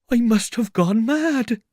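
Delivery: very high, loud wail; distraught tramp. Acted. Tramp hearing voices, distraught. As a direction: wail as if your mind has gone. Tramp doubts his sanity aloud.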